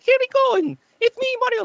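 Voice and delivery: mock the chirpy plumber catchphrase; Mario voice. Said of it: "listening to the Mario voice".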